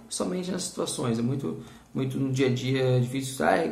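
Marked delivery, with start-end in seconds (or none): none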